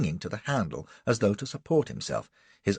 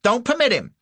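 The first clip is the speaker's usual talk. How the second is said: high, loud protective scolding; fierce old lady; 12 semitones higher, 8 dB louder than usual. A narrator's reading. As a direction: stand up to the bully. Woman defending Cassie fiercely.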